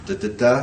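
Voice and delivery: da da DA anapest beat; chanted metrical beat; rhythm demo. Shown as a demonstration. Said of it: "what is anapest?".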